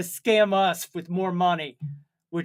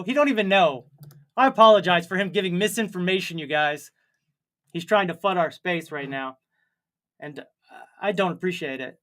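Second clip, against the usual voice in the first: ordinary delivery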